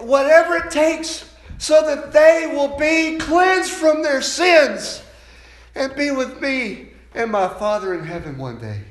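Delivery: loud, high, slow preaching shout; shouted vow. Acted. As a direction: thunder each phrase, then ease off. Jesus' vow shouted at climax.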